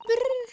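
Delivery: loud high mock shiver; shivering brrr. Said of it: "sends a chill down your spine".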